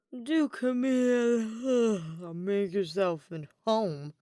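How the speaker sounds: very slow, sarcastic grumble with a sigh; grumbling. A narrator's reading. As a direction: drag it out, sleepily annoyed. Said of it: "the noble grumbled".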